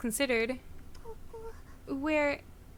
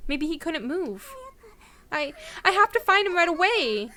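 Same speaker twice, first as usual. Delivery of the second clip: worried; 5 semitones higher than usual. Acted. Character panics for brother.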